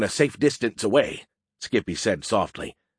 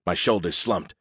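ordinary delivery, nothing marked

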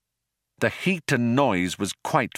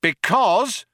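high, loud shout; cop shouting; 12 semitones higher, 4 dB louder than usual. A narrator's reading. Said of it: "shouted the cop".

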